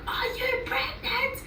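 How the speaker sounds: high, slow stunned question; a friend's incredulous voice; shocked question. Retold or imitated. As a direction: ask it in disbelief.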